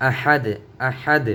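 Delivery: "আহাদ" ending on a bounced d, no -un; stopped ending. Shown as a demonstration. Teacher repeats the pause form, "আহাদ" without -un. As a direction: halt "আহাদ" on an echoed d. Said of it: "দুই পেশের উচ্চারণটা এখানে হবে না".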